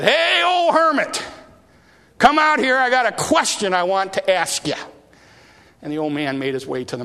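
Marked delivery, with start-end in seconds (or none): 0.0-4.7 put-on loud, high teen shouting up; cocky teenage boy. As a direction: yell up at the cave mouth. Boy taunts hermit, calling out.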